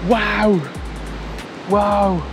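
usual speaking voice

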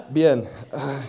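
usual speaking voice

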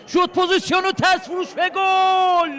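fast build, then a long high goal scream; screaming football commentator. Real, not acted. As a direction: build to a stretched goal yell. Goal scored in live match.

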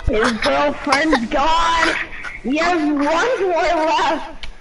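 slow, drawn-out menace; unhurried villain voice, sinking low at the end; psychopathic killer. Pretend. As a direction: toy with your prey, slowly. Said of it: "freaking psychopathic".

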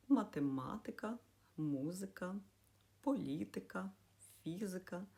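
«математика», «музика», «політика», «фізика», each stressed third syllable from end. Shown as a demonstration. Teacher lists foreign words, stressing third from end in «математика», «музика», «політика», «фізика». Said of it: "коли наголос падає на третій склад".

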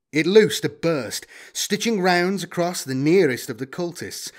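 ordinary delivery, nothing marked

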